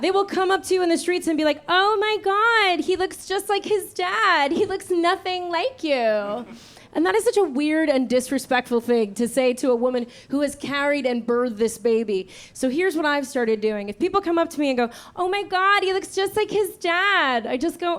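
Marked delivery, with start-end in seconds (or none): 1.7-6.4 high, drawn-out mock amazement; gushing stranger. Imitating gushing strangers in the street. 15.2-17.5 high, stretched-out mock gushing; gushing stranger. Repeats the gushing strangers' line.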